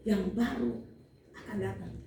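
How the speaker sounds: ordinary talk, nothing marked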